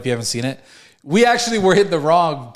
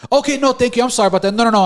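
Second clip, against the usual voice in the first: high, louder mock apologetic clerk; voice jumped up octaves; 8 semitones higher, 4 dB louder, faster than usual. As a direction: imitate a flustered apology in a raised pitch. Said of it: "her voice jumped up a couple octaves".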